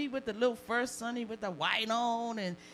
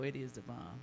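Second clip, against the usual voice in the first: sly aside; 7 semitones lower, 7 dB quieter than usual. Real, not acted. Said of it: "softer repeat".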